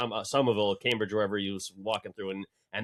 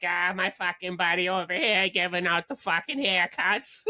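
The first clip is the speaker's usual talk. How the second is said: higher Boston-guy impression; mock Boston voice; 7 semitones higher than usual. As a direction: shout out the barber like a Boston regular. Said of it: "And he's like".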